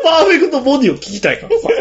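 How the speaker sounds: laughing. Real, not acted. Laughing while quoting the catchphrase.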